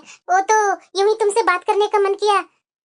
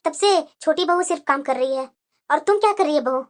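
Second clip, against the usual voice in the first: lower mother-in-law voice; 7 semitones lower than usual. Acted. Mother-in-law scolding elder bahu.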